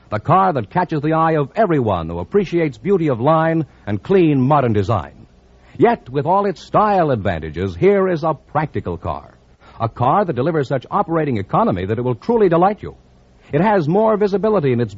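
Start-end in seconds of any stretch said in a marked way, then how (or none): none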